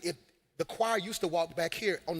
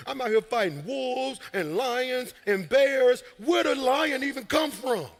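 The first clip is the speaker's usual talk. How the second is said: complaining David; 4 semitones higher, 5 dB louder than usual. Retold or imitated. Preacher mocking David griping about lions and bears, whiny. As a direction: pitch up, louder, griping and whiny, slowing as it builds. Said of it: "This what you sound like right now".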